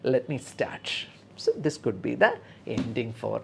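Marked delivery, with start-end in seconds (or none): 0.0-1.1 slow, soft reassuring nurse cue; gentle slow cue. Shown as a demonstration. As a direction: draw it out calmly for the patient. Nurse starting procedure.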